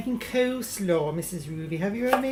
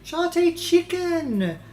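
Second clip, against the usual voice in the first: exclaiming; 9 semitones higher, 8 dB louder, slower than usual. Acted. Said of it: "Not famished".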